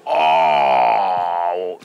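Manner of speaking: long low "oh"; ominous groan. Retold or imitated. Security guard learning he's the bodyguard, ominous groan. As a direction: let out a drawn-out, deep groan of dread.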